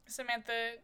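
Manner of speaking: ordinary delivery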